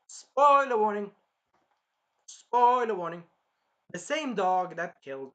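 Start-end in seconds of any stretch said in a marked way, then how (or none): 0.1-1.1 high, drawn-out warning call; loud spoiler alert. Real, not acted. Reviewer warning viewers before a spoiler, loudly calling out. Mock announcement before a plot reveal. 2.3-3.2 loud spoiler alert. Real, not acted. Reviewer warning viewers before a spoiler, loudly calling out.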